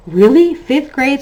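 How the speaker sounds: amazed. Retold or imitated. Both parents marveling out loud at fifth grade. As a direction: marvel at it together. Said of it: "we both were like".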